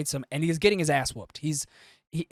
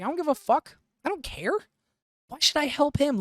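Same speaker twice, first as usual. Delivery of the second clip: much higher, jeering mock voice; mocking Guts; 11 semitones higher, 4 dB louder than usual. Guts brushing off the plea for help, high mocking voice. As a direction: sneer it out in a squeaky put-on voice. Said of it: "And Guts is like".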